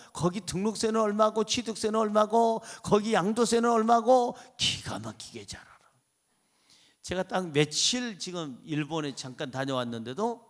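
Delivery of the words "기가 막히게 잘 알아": whisper. Acted. Wry aside on real estate talk.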